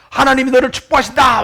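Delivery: loud, high retold call; crying out. Mother tells her son what to shout, crying out. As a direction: proclaim it as a model shout. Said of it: "외쳐보라는 거예요".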